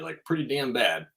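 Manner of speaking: normal talking voice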